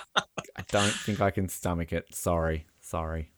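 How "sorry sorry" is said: low, very soft sorry; subdued apology. Real, not acted. Apologises for refusing the film.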